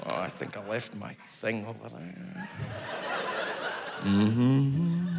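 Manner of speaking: low, soft Sean Connery murmur, pottering about; Sean Connery gardening. Retold or imitated. Sean Connery pottering in his Bahamas garden, low soft murmur. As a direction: hum contentedly to yourself while pruning roses, low and soft. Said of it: "he's like".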